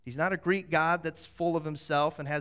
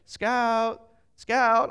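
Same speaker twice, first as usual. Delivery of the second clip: loud, higher searching call; calling a lost dog; 6 semitones higher, 9 dB louder than usual. Retold or imitated. Searchers going around the streets for a runaway dog, calling out.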